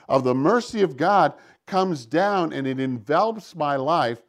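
ordinary talk, nothing marked

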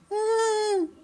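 high strained hum of alarm; a mother's tight, high worried "mm"; mother hearing her son talk in church, a high anxious hum. Retold or imitated. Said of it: "my mother's going".

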